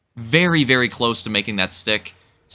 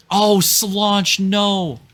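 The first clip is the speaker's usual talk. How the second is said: high pained oh no; dismayed cry; 9 semitones higher, much slower than usual. Real, not acted. Rider crashes badly.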